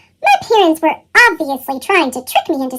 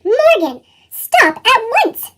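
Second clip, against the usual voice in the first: slow, firm command; ordering; 6 semitones higher, slower than usual. A narrator's reading. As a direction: stop her at once, no argument. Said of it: "Mister Z ordered".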